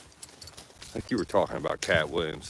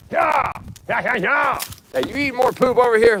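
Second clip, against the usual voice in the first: high, loud yell at a dog; sharp calling out; 8 dB louder than usual. Real, not acted. Speaker to a dog eating poop, sharp high shout.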